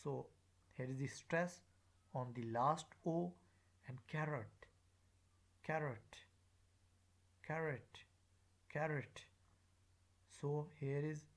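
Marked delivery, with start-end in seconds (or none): 4.1-9.2 "carrot" stressed first, ending in a weak "-ət"; O as schwa. Shown as a demonstration. Teacher drilling schwa, weak O in "carrot". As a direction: reduce the O in "carrot" to "uh". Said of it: "the O is pronounced as the schwa sound".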